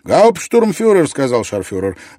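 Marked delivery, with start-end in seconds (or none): 0.0-1.1 loud, high, formal hail; military address. A narrator's reading. Scharführer addresses his captain, loud and formal. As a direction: address a superior at full voice.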